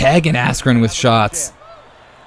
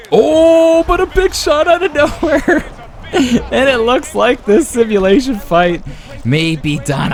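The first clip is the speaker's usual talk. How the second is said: excited big-shot call; 12 semitones higher than usual. Real, not acted. Commentator calls a big shot out of nowhere, thrilled. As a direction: shriek the surprise, then ease down through laughter.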